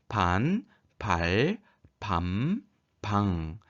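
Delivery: 반, 발, 밤, 방 one by one, final sounds drawn out smoothly; resonant final consonants. Shown as a demonstration. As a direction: let the endings of 반, 발, 밤, 방 ring on in mouth and nose. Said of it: "소리가 부드럽게 이어지는 느낌".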